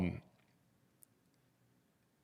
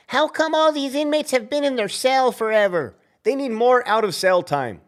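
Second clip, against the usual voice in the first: higher put-on voice of the bosses asking; imitating the administration, higher; mock official complaint; 8 semitones higher than usual. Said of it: "They wanna know".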